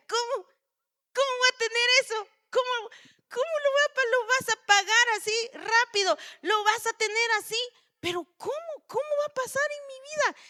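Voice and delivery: put-on high, fast incredulous old woman; mock-amazed Sara asking how; doubting Sara. Preacher voices doubting Sara.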